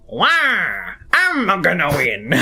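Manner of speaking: lightly voiced Waluigi boast; a hint of Waluigi gloating; Waluigi impression. Streamer demos Waluigi, barely different from Wario. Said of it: "That's Wario and Waluigi".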